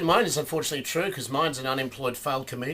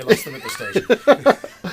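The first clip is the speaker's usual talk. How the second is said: laughing; 11 dB louder, slower than usual. Real, not acted. Joke lands, laughing.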